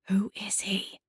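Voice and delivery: hushed, secretive whisper; barely above a whisper. A narrator's reading. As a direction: ask it very softly, nearly all breath. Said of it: "my voice barely above a whisper".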